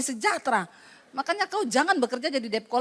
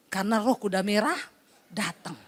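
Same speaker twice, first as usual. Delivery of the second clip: ordinary talk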